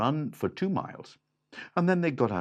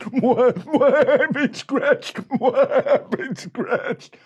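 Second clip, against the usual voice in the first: high loud laughing panic; scratched bandit; 11 dB louder, slower than usual. Acted. Scratched bandit yelps in high panic. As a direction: yelp it half laughing.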